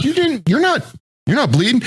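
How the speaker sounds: very high, disbelieving protest; incredulous. Retold or imitated. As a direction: push back in disbelief. Disbelief he is wounded.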